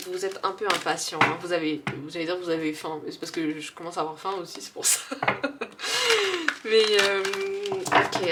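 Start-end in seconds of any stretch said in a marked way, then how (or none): 5.0-6.6 high, drawn-out laugh; sheepish laugh. Real, not acted. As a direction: laugh at her own hunger. Laughs about being hungry. (rire gêné, aigu et prolongé)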